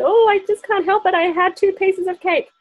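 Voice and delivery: high, sheepish confession; lightly acted guilty sigh; guilty excuse. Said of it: "a lot of us say".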